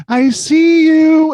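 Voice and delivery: high, loud and slow creepy taunt; sing-song menace through the door; creepy Gary Coleman. Retold or imitated. Retelling Molly's story, voicing Gary Coleman at the door, high and loud. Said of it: "Gary is on the other side of the door going".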